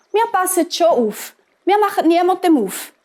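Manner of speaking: put-on high, eager kids' voice; high-voiced little kids reassuring mum; little goat children. A narrator's reading. Kids reassure their mother.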